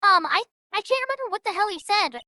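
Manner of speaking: ordinary talk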